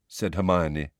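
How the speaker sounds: usual speaking voice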